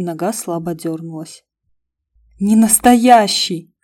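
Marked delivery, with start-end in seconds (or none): 2.4-3.7 slow, loud relieved cry; delighted relief; overjoyed. Acted. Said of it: "обрадовалась я". (радостное облегчение)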